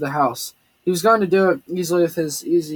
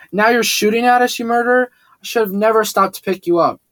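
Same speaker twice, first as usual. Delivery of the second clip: higher and louder, accusing; furious outburst; 5 semitones higher, 3 dB louder than usual. A narrator's reading. Xavier laying into Tyler after being shot at, furious and loud. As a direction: tear into him, voice rising in fury. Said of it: "while Xavier let Tyler have it".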